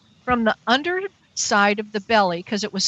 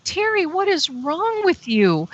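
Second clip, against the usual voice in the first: high, slow, drawn-out exasperation; exasperated self-scolding; 8 semitones higher than usual. Retold or imitated. Woman scolding herself for begging, exasperated. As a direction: take yourself to task, pitch raised.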